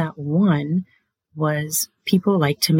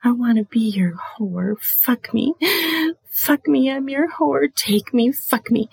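high whispered begging; high, hushed submissive plea; needy whisper; 7 semitones higher than usual. Shown as a demonstration. Demo of submissive dirty talk.

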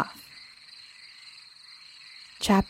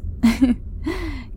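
short, higher acted chuckle; laugh; 6 semitones higher than usual. A narrator's reading. Kingsley after being thanked, a short laugh. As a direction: give a brief laugh. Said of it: "Kingsley laughed".

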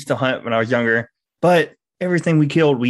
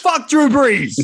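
higher, louder playful jeer; mock taunt; 11 semitones higher, 4 dB louder, much slower than usual. Falcons fan jeers at Saints quarterback, mock taunt. Sports rivalry banter.

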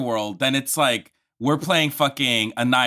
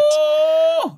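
high excited squeal; 4 dB louder than usual. Real, not acted.

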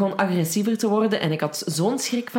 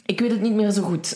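usual speaking voice

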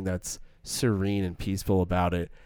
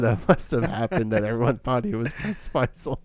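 put-on wholesome 1950s kid; 3 semitones higher than usual. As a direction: play a wide-eyed innocent kid. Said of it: "part of me wants to be like".

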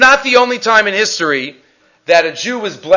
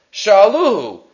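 ordinary talk

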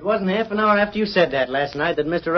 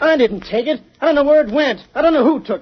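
frantic pleading; 8 semitones higher than usual. Acted. Accused son pleads, interrogated by sheriff.

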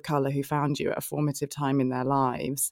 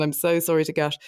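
ordinary talk, nothing marked

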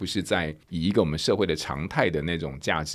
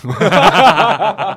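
high, loud cackling laugh; loud burst of laughter; 13 semitones higher, 14 dB louder than usual. Real, not acted. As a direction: burst out laughing at being caught out. Host laughs at being put on spot. (被说中后放声大笑)

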